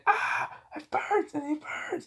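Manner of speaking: very high, thin squeal of pain; mock pained cry. Man whose jacket is burning, mock pained squeal. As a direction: squeal like you're on fire, hammed-up. Character's finger-burn gag, mock screaming.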